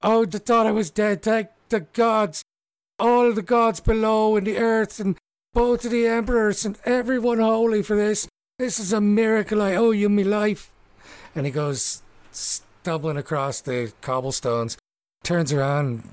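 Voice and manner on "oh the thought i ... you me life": high, loud overjoyed babble of thanks; gushing relief. Acted. Mickey thanking everyone after being released, overjoyed gush.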